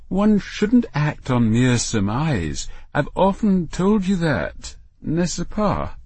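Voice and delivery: precise, slow and lofty; a refined mother correcting her child; prim mother voice. Acted. Irene lecturing her son.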